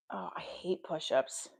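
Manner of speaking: whispered groan of dislike. Real, not acted. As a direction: whisper it, fed up. Whispered complaint during workout.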